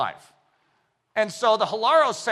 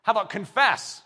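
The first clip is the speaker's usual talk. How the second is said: ordinary talk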